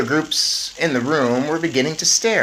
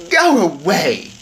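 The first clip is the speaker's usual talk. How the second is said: lightly voiced Mrs Jenkins; 5 semitones higher, 7 dB louder, slower than usual. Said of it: "Miss Jenkins said".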